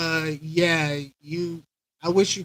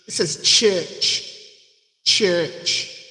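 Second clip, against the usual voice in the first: churchy reverb; much slower than usual. Shown as a demonstration. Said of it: "This sounds a little churchy".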